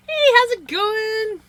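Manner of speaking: high, loud, drawn-out mock Canadian greeting; exaggerated Canadian accent. Streamer showing what her accent isn't, exaggerated Canadian. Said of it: "the Canadian accent".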